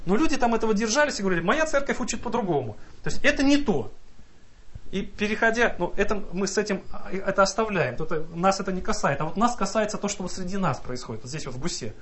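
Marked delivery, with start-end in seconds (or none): none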